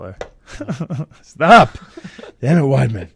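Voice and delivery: a tearful laugh cracking through the words; crying laugh. Real, not acted. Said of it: "Damn it, you made me cry".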